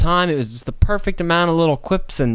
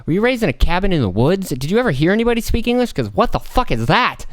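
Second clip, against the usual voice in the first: incredulous; 7 semitones higher than usual. Retold or imitated. Voiced incredulous reaction.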